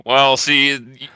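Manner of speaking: ordinary talk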